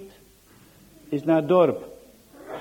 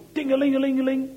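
bell imitation; 6 semitones higher, faster than usual. Imitating doorbell.

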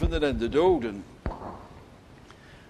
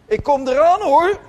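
much higher and louder, rising at the end; warning call up the stairs; 11 semitones higher, 8 dB louder than usual. Retold or imitated. Parent at the foot of the stairs warning quarrelling children, loud call. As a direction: call out a threat to the kids upstairs.

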